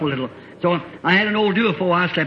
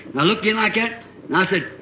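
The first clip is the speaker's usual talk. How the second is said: ordinary talk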